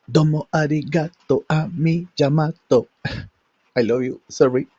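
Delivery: usual speaking voice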